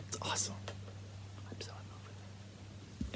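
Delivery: soft breathy whisper, barely there. Real, not acted. Whispering a confession of love for the blue baby. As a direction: confide it like a secret.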